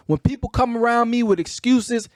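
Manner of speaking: ordinary talk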